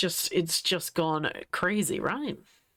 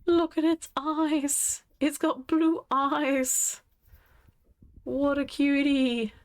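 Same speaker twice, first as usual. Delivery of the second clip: cooing; 8 semitones higher, slower than usual. Real, not acted. Streamer admiring a husky's blue eyes, cooing high and slow. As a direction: melt over an adorable animal, pitched up and drawn out.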